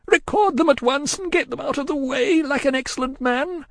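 high, loud distraught cry; wildly crying. A narrator's reading. Miss Pross hurrying Cruncher along, wildly distraught. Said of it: "still wildly crying".

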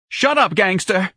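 high, loud, slow-hurled retort; wild. A narrator's reading. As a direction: lash out wildly at him. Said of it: "Missus Levy answered wildly".

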